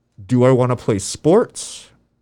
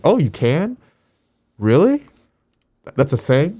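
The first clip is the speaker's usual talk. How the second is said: surprised; 6 semitones higher than usual. Retold or imitated. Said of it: "And I was like".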